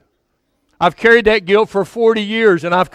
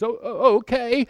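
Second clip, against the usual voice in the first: put-on lightly higher, softer small-boy voice; little boy voice; 6 semitones higher, 5 dB quieter than usual. Preacher voicing the crying boy, lightly higher and softer. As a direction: meekly answer as a hurt little boy. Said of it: "He says".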